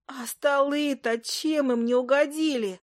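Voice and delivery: high, slow creature lament; groaning familiar. A narrator's reading. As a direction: wail over the damage in a put-on voice. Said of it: "стенула между тем Фая".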